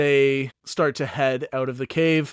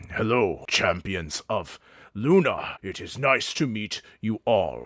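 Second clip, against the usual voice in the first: a hulking orc sizing them up; muscled orc meeting the champions, lower and measured; big orc Mountain; 3 semitones lower than usual. Acted. GM voices huge orc Mountain.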